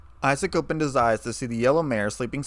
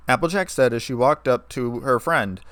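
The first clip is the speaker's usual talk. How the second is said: usual speaking voice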